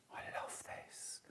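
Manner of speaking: a soft, breathy whisper of delight. Real, not acted. Host falling for a new fabric, whispering. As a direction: whisper it like a secret crush on the fabric.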